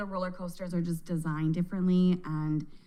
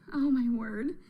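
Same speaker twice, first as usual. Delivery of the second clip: ordinary delivery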